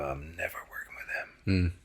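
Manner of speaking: hushed, barely voiced vow; whispered resolve. Son walks away from John and whispers a vow. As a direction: mutter it to yourself walking off.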